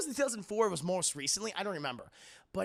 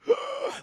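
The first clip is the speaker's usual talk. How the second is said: wheezing gasp; 7 dB louder than usual. Retold or imitated. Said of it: "Vader's breath is weakened".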